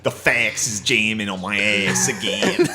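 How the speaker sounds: slow, put-on Delco bro grumble; Delco accent impression. Mocking a Delco office guy, slow Delco accent. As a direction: drawl a Delco complaint about the fax. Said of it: "Delcos who get their way in office".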